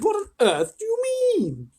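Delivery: very high, loud crying-out voices; alarmed squeal. A narrator's reading. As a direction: wail it in shock. Group cries in alarm.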